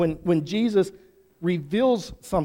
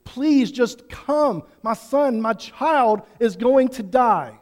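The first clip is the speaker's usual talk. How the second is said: raised, slow desperate plea; begging and pleading; 5 semitones higher, 6 dB louder, slower than usual. Retold or imitated. A father begging Jesus for his dying son, pleading. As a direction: beg Jesus to come, voice lifted. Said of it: "He was begging and pleading".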